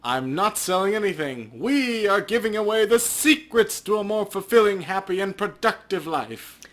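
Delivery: high, loud, grand pitch, slowing at the end; hammed-up cult recruiter; smooth recruiter. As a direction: sell the dream with big smiling promises. Patrick answering the player.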